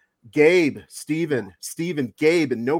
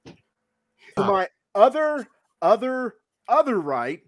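stretched ring announcer call; grand entrance announcement; 6 semitones higher than usual. Acted. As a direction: drag out each other like a boxing MC. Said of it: "That was the best intro ever".

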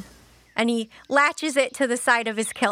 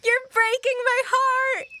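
high, tearful little wail; mock heartbreak; 12 semitones higher than usual. Player reacting to the waiting pet, mock heartbroken. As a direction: whine it as if close to crying.

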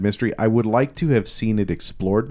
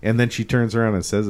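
usual speaking voice

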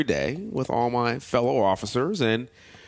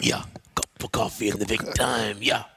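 Macho Man Randy Savage. Retold or imitated. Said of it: "Macho Man Randy Savage".

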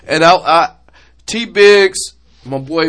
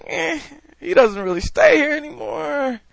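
mock whiny parent; 9 semitones higher, 4 dB quieter than usual. Mocking a parent's lament.

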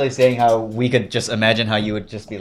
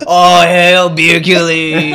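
slow, loud hail; grand proclamation; 5 semitones higher, 8 dB louder, much slower than usual. Acted. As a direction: bellow it like a herald. Toasting to Hercules.

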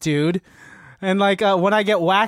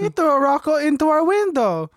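high-pitched secretary arguing her case; put-on high voice, insistent; pleading secretary Sasha; 9 semitones higher than usual. Secretary argues with mediator, high insistent voice.

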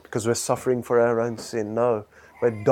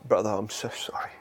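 soft apology; 3 semitones lower, 8 dB quieter than usual. Acted. Acted apology to brother.

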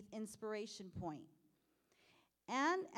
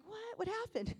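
surprised crowd; 8 semitones higher than usual. Retold or imitated. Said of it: "Everybody would be like".

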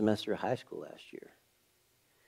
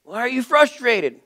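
much higher, louder exasperated question; frustrated dad; 12 semitones higher, 5 dB louder than usual. Retold or imitated. Dad losing patience with son, high loud frustrated question. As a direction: snap the question out. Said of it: "I was getting frustrated".

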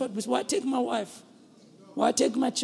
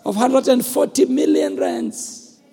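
higher and louder sermon shout; preaching at full force; 7 semitones higher, 10 dB louder than usual. Acted. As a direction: drive the message home from the pulpit. Preaching forgiveness at full volume.

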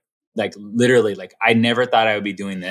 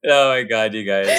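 after laughing hard. Real, not acted. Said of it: "I'm crying laughing".

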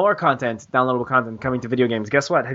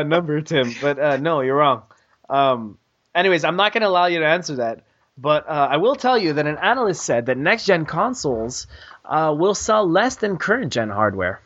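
ordinary talk, nothing marked

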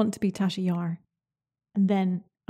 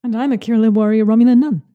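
loud, fast hammed-up boast; playful alter-ego shout; mock proud declaration; 12 dB louder, faster than usual. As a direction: proclaim it like a warrior. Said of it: "we have alter egos now".

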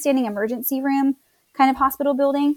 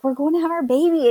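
retold delight, pitched up; excited; 5 semitones higher than usual. Husband in the truck on the way to birth, excited. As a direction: gently imitate an excited father-to-be. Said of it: "he's just so precious and excited".

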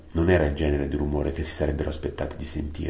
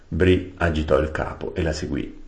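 plain reading voice

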